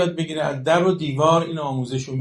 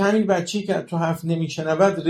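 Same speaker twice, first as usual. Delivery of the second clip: normal talking voice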